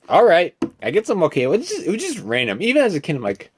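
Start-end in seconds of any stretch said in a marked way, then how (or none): none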